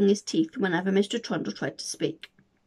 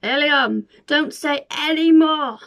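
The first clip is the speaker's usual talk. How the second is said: louder urgent call; 6 semitones higher, 7 dB louder, slower than usual. Acted. Santa warning William, loud urgent call. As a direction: call out to stop him. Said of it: "Santa called".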